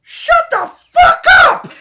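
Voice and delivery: slow, very loud high screamed shout; angry shout. Retold or imitated. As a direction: bellow it furiously. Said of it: "here's what I hear".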